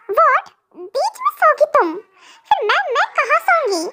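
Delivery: wife startled that the niece wants the middle spot; shocked wife. Acted. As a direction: sound caught off guard.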